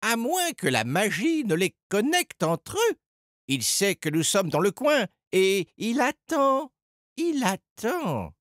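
high character voice, slowing at the end; Edgar, working it out. A narrator's reading. Edgar pieces together the rabbit's plan, high and suspenseful. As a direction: reason aloud, stretching the last words.

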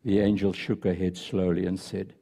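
soft, hushed storytelling read; story narration. Acted. Pastor reading a story to the congregation, softly told. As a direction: read the tale gently to the congregation.

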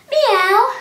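high loud rising meow; a big cat meow. Shown as a demonstration. Teacher counting cat sit-ups with a loud high meow. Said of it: "cat sit-ups".

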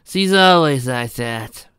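high, slow, mild exasperation; mildly irritated, drawn-out complaint; a bit annoyed. A narrator's reading. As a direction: stretch it out, loud and slightly fed up. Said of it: "a bit annoyed".

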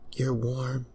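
comforted princess; soft princess. Acted. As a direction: murmur it as you cling. Princess clinging.

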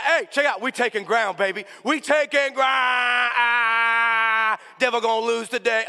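high, loud victory holler; hyped-up kid yelling. Retold or imitated. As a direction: shout it out, stretching the last word. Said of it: "no problem hyping himself up".

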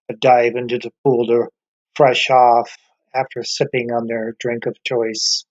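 normal talking voice

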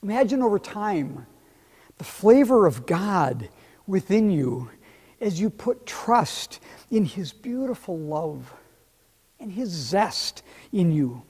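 ordinary delivery

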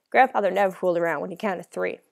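plain reading voice

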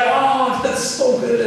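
a lovestruck sister gushing; mock swooning Mary. Mary sitting at Jesus' feet, gushing in a high dreamy voice. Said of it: "she was like".

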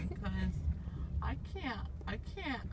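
ordinary talk

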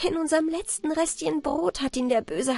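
high, delighted exclamation; joyful cry. A narrator's reading. Anna Barbara senses her golden coin in the bread, joyful cry. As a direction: call it out high with joy. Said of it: "rief freudig".

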